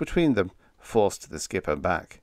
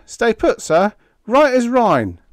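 very high, loud Cockney sing-out; cheerful Cockney; 10 dB louder than usual. A narrator's reading. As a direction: chirp back like a cheery Cockney steward. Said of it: "voice of our Cockney steward".

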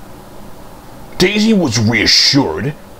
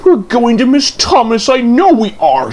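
high, louder fretting complaint; fuming; 10 semitones higher, 3 dB louder than usual. Acted. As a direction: fume about missing Thomas. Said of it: "she fumed".

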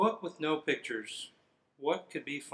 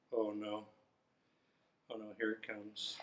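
much softer, lower dread; 6 semitones lower, 12 dB quieter than usual. Real, not acted. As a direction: brace yourself quietly. Dreading silly sounds.

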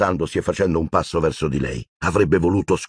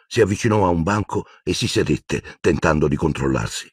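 ordinary delivery, nothing marked

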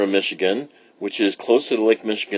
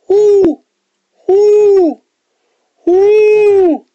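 very high, loud owl hoots; 11 dB louder, much slower than usual. Acted. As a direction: hoot loud and high like an owl calling the sun. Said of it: "and hooted".

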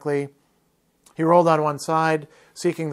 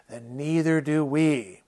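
plain reading voice

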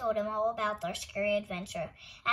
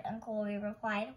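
ordinary delivery

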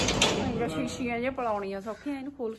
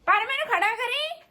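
dramatic loud aunty; 10 semitones higher, 4 dB louder than usual. Real, not acted. High loud plea.

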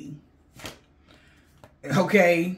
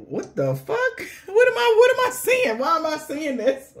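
high, squealing what-am-I-seeing; shocked disbelief; 10 semitones higher than usual. Retold or imitated. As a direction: recoil in horror at the sight, voice leaping up. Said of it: "I said".

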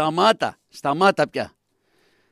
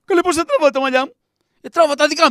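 shrill mock protest; 8 semitones higher, faster than usual. Wife mocked for fake crying.

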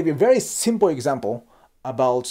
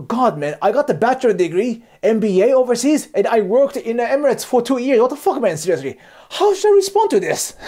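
higher-pitched, mock-incredulous venting; exasperated inner rant; 7 semitones higher, 4 dB louder than usual. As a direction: vent in high, heated disbelief. Acted inner outburst at colleague's boasting.

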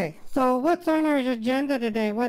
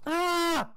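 mock scream; 6 semitones higher than usual. Woman retells being spooked by the voice, startled. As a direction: let out a small high retold scream. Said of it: "And I'm like".

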